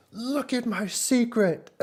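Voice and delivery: high, drawn-out excited impression; hyped-up owner. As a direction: imitate a man at full hype, stretching it out high. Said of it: "B B B Man's same hype level".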